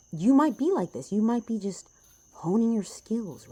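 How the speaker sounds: loud, high, slowing on honing your skills; fired-up encouragement. Real, not acted. Passionate encouragement to viewers.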